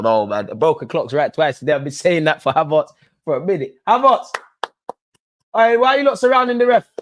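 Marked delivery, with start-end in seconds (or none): 3.9-6.9 higher, louder cheer; cheering shout. Real, not acted. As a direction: call out his name proudly. Excited cheering.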